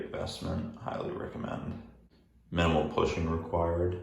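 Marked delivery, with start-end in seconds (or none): 0.0-1.8 soft whispered aside; whispering. Real, not acted. Whispered aside about product.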